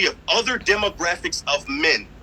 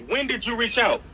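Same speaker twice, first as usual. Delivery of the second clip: ordinary talk, nothing marked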